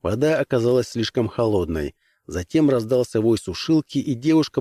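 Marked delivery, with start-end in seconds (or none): none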